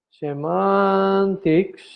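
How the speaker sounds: "Semantics" drawn out very slowly; slow dictation. Shown as a demonstration. Teacher repeats a key term for the class, slow dictation. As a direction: stretch the middle of "Semantics", syllable by syllable.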